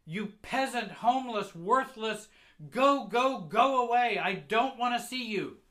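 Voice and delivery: very high, very loud rejection; furious mother shouting. Acted. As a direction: scream insults and drive the child away. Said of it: "She shouts".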